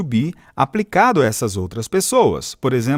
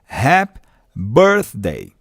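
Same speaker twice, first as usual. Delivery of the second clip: slow model phrase; 2 semitones lower, much slower than usual. Shown as a demonstration. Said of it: "feliz aniversário".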